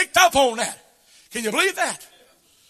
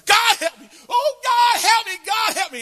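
pleading son's prayer; 12 semitones higher than usual. Retold or imitated. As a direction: cry out for help like the boy at the altar. Son crying at the altar.

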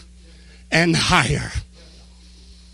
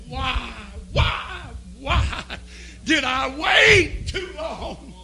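high, drawn-out acted wail; anguished cry, rising high; 7 semitones higher, slower than usual. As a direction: wail it in bitter regret. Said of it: "People screaming and crying, wringing their hands".

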